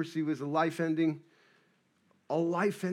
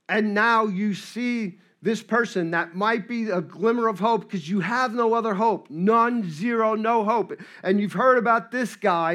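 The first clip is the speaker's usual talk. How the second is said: louder, higher, urgent storytelling; forceful sermon delivery; impassioned preaching; 5 semitones higher, 6 dB louder than usual. Real, not acted. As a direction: build the desperate hope for the congregation.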